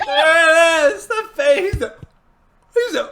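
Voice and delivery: high loud slow laughing cry; laughing shout. Real, not acted. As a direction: crack up shouting. Laughing at friend's face.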